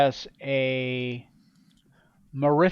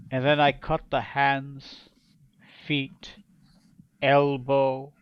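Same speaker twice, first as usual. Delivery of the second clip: menacing pirate king. Acted. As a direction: tell your rise to power like a cold tyrant savoring every cut. GM voices the king's tale.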